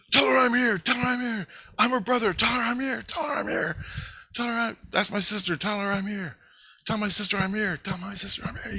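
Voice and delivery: high, insistent pleading in mimicry; imitated frantic brother. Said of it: "he's screaming right in my face".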